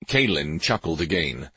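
ordinary delivery, nothing marked

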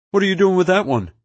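high, loud, worried question; alarmed demand. A narrator's reading. Alarmed gunman questions Nefarious.

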